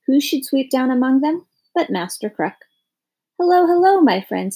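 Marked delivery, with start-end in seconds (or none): 3.4-4.6 a lightly voiced big bird, higher and louder; cheery crow greeting. A narrator's reading. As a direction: greet friends from the sky, a touch birdlike. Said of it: "he said".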